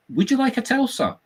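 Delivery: very high sweet offer; eager clerk. Retold or imitated. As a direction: tempt him with the offer.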